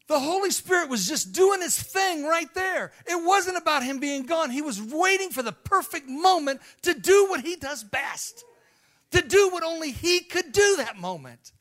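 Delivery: loud, high, impassioned preaching shout; rousing revival preacher at the peak; preaching at full force. Acted. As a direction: lift the congregation with a fiery cry. Preacher building to climax.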